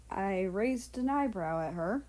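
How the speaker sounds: usual speaking voice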